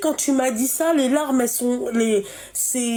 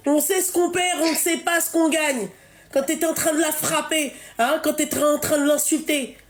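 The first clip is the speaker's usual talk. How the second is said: raised, indignant scolding; 4 semitones higher than usual. Real, not acted. Host berating a caller who hit her mother, raised and indignant. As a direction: lecture her hard on regret, voice raised.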